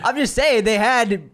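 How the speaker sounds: high, loud, drawn-out protest. Real, not acted. Cohost defends himself when accused of assuming, high protesting. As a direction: plead your case, voice shooting up slowly. Laughing protest in mock argument.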